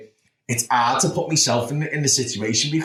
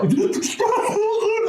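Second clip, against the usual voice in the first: high broken laugh. Real, not acted. Emotional retelling of breakdown.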